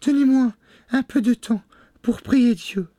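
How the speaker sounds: very high pleading voice; tearful wife. A narrator's reading. Wife begging Bluebeard for time, high and pleading. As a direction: beg for mercy in a falsetto. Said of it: "des yeux baignés de larmes".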